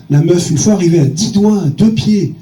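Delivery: ordinary talk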